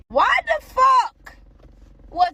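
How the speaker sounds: slow, high, loud outburst; indignant drawn-out why the fuck; outraged. Real, not acted. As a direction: stretch it out in disbelief. Outraged rhetorical question.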